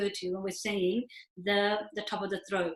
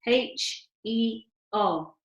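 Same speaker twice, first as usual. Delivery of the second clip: spelled-out letters; much slower than usual. Shown as a demonstration. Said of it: "equivalent of saying".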